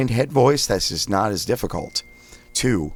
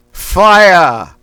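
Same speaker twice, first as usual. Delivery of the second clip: Boston accent; 11 semitones higher, 13 dB louder than usual. Shown as a demonstration. Coach demonstrating Boston vowel drill, loud thrust-out Boston Fire. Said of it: "We say fire like a Boston accent".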